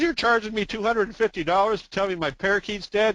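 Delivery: incredulous, hammed-up complaint; indignant customer. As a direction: protest the bill in disbelief, as a joke character. Joke's owner protests the vet's bill.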